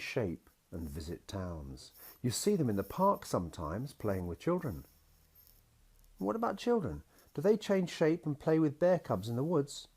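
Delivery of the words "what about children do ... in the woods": put-on high, eager child asking; a small girl's bright question; little girl voice. A narrator's reading. Daughter asks her daddy in a high, eager little voice.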